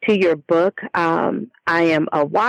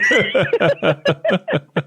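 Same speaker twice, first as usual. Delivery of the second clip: a real laugh at her own muddle; self-amused laugh. Real, not acted. Guest laughing after losing her train of thought, self-amused laugh. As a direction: laugh it off at yourself.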